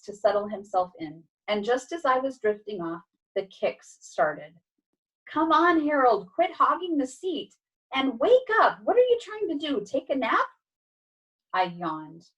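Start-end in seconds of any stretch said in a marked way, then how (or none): 5.3-10.5 high, pushy scolding; exasperated nagging. Acted. Cat kicking the drowsy dog awake, exasperated. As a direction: nag a sleepy friend awake.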